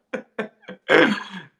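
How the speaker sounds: loud high real laugh. Real, not acted. Comedian after apologising to Liverpool, loud high laugh.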